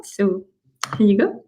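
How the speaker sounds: ordinary delivery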